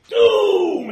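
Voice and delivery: high, loud, drawn-out shout falling away; another creature's cry. Acted. Another creature calls from off the side, ominous shout. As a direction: call it out piercingly as an omen. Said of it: "you hear another voice off the side saying".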